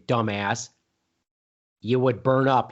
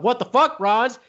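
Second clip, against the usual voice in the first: exasperated outburst; 9 semitones higher than usual. Acted. Mock debate, escalating berating.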